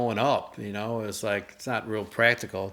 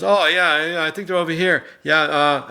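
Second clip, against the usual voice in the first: clerk voice; 6 semitones higher, 6 dB louder than usual. Retold or imitated. Retold clerk answering.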